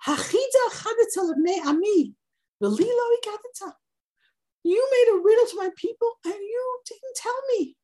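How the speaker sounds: high, plaintive complaint; guilt-trip him in a high whine; wheedling wife. Retold or imitated. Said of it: "the classic womanly wiles".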